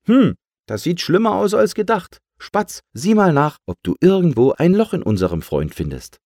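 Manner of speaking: high, loud cartoon pump taking charge; concerned helper, loud and high. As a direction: pitch it up and give orders to the sparrow. Pump character examines the balloon.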